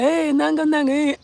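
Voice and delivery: high mock-friendly greeting; fake friendliness. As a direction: put on a high, chummy hello. Mimics fake-friendly people greeting.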